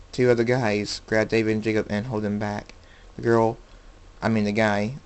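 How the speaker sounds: ordinary delivery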